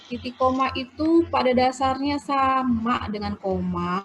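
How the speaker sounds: slow, drawn-out teaching pace; slow explaining. Real, not acted. Teacher explaining semicolons carefully to students, slow explaining. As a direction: spell out the rule slowly, stretching the words. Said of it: "saya menjelaskannya pelan-pelan".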